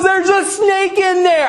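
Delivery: high, drawn-out cry of alarm; slow it down and pitch it way up in comic fright; mock panic. Manager warning about what is under the Tahoe, mock panic. Terrified manager repeats his discovery.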